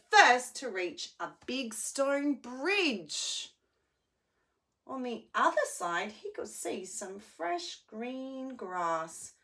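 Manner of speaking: animated storybook narration; reading to children. A narrator's reading. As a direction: tell it like a bedtime story.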